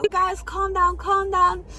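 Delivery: high-pitched imitation of a teacher; teacher calming pupils. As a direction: mimic a teacher breaking up an argument. Teacher stepping into the quarrel.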